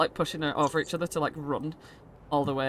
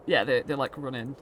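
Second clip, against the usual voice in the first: ordinary delivery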